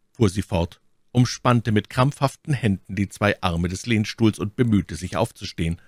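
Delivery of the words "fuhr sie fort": plain reading voice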